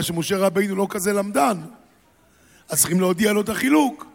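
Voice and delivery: ordinary talk, nothing marked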